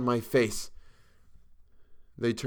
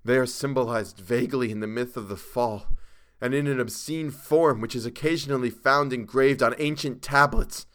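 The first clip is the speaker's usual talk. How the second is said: starting restrained, building to a high, loud cry; rising to scream; 5 semitones higher, 7 dB louder than usual. A narrator's reading. Chalmers describing the awful creatures, voice rising to a scream. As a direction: build steadily from hush to near scream. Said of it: "His voice had risen to a scream".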